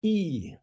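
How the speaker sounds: "E" as a long ee, like sushi; a clearly set-off demonstration "E"; pronounced E. Shown as a demonstration. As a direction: say "E" on its own, the ee vowel. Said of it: "It is pronounced E".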